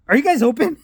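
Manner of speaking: high-pitched, rising retold question; hopeful second ask. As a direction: imitate a puzzled customer asking at the door. Dad tries again at the door.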